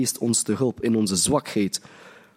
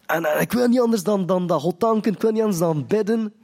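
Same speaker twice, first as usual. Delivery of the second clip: gushing new convert; 6 semitones higher than usual. Retold or imitated. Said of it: "getuigenissen van de christenen die net zijn bekeerd".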